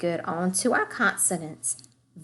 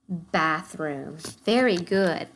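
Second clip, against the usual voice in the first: usual speaking voice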